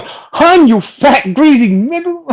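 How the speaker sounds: retold movie villain, slow and high; hammed-up villain taunt. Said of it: "he goes".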